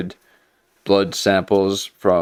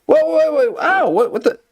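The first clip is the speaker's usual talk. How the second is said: shrill mock yelp, much higher in pitch; startled guy; 4 dB louder than usual. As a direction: caught off guard by a needle, hammed-up panic. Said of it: "the guy's like".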